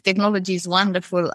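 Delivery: ordinary talk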